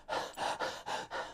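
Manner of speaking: quick, quiet breaths in and out; winded panting. Shown as a demonstration. Coach shows fighters winded in the corner, panting. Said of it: "'Cause they like this".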